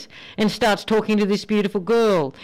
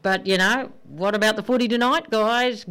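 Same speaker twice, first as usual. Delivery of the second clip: ordinary delivery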